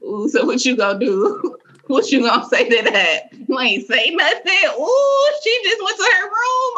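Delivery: instigating onlooker, rising to high pitch; gleeful mock egging-on with laughter; Shawnee. Onlooker goading Bianca after the clapback, mock taunting. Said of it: "Shawnee be like".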